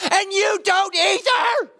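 high, slow accusing holler; exasperated shout in a retold fight; reenacted yell. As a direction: yell it at full force, stretching the words. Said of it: "here's what I wanted to yell".

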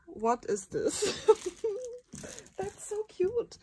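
high teary laugh; laughing almost crying. Real, not acted. Overwhelmed by the cute gift, teary laughing. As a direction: laugh through near tears.

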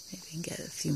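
soft unvoiced whisper. Real, not acted. Gardener filming beans close up, whispering. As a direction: hush it, as if not to disturb the plants.

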